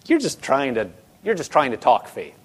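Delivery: low, soft, dismissive mimicry; skeptical doubter. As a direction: imitate someone who doesn't believe it. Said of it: "you could hear it in people's voice".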